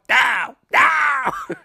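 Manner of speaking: hammed-up villain's falling yell; mock cry of defeat. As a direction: wail it as the villain goes down, in jest. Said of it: "It was all like".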